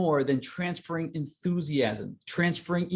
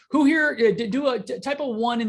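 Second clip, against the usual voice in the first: high and loud, pumping up the room; rally them, voice up; rallying call; 7 semitones higher, 4 dB louder than usual. Real, not acted. Coach firing up his students over the chat, excited call.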